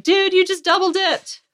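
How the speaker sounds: high put-on voice for the husband; mock husband. Wife imitating her husband calling her out, high mock voice. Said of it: "And he said that loudly".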